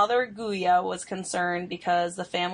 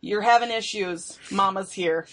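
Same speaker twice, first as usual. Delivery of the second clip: overbearing mom voice; 3 dB louder than usual. Pretend. Mom act, timbre shifted.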